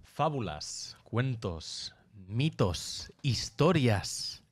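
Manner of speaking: measured, dramatic opening narration; podcast intro. Acted. Host opening a mythology podcast for listeners, dramatic intro. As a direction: announce each word on its own, drawing out the last.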